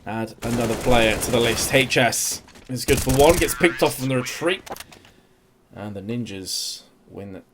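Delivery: energetic esports caster calling the action; live play-by-play. Acted. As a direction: call every duel as it happens.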